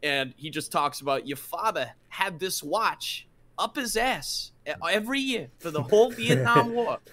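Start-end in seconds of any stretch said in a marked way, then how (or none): 1.3-7.0 higher, halting movie-monologue impression; Christopher Walken. Retelling a favorite Pulp Fiction scene, halting Walken impression. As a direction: stretch phrases, pausing oddly between them. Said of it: "the scene with Walken".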